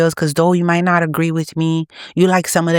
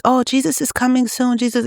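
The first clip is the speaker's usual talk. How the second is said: mocking quote; 8 semitones higher than usual. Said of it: "It's no longer the generation of just".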